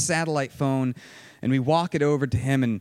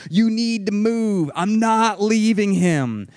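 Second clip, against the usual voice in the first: louder, slower retold insistent yell; 4 semitones higher, 4 dB louder, slower than usual. Phil yelling back that they must move, slow retold yell. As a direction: hammer the order home, retold. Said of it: "Phil's yelling back at him".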